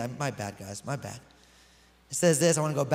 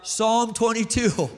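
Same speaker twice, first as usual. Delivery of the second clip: drawn-out, mildly raised cry; mock scream; 3 semitones higher, 3 dB louder, slower than usual. Preacher imagining Jesus on the cross today, mock screamed out. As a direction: call it out slowly, like a restrained scream. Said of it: "he probably would have screamed out".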